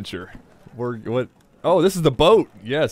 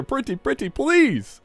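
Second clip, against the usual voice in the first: high mock pleading; 10 semitones higher, slower than usual. Begging the game to start.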